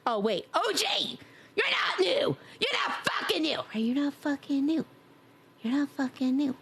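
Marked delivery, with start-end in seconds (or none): 0.5-3.6 high-pitched, squawking yell; mock-outraged shout. Real, not acted. Streamer calls out a regular viewer, shouting high. 3.7-6.5 raised pitch without shouting; toned-down mock protest. Real, not acted. Streamer repeats the tease to a viewer, no longer shouting.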